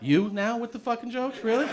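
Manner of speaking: high, loud comic exasperation; mock indignation. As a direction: act fed up with the joking doctor. Retold protest at joking doctor.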